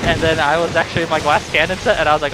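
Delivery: normal talking voice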